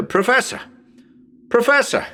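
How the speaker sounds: high calling Professor!; Professor! called high, listening for the echo. Acted. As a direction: call up into the empty house. Calling out in empty house.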